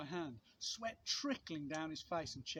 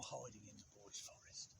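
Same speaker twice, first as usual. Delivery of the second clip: a secretive whispered question; suspense whisper; 9 semitones lower, 14 dB quieter than usual. A narrator's reading. Asks the listening children about the picture, whispering.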